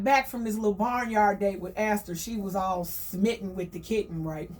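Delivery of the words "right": ordinary talk, nothing marked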